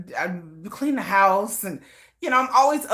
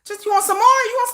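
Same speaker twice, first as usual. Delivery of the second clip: high mock drunk refusals. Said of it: "I'll be like".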